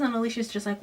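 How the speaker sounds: usual speaking voice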